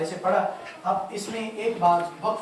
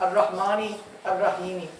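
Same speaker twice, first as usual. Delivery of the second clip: अर्रहमानी and अर्रहीमी as two detached, unchanted words; wrongly separated reading; 3 semitones lower than usual. Shown as a demonstration. Teacher showing how not to recite, अर्रहमानी and अर्रहीमी kept apart. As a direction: break between अर्रहमानी and अर्रहीमी without any chant. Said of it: "यूँ नहीं पढ़ना".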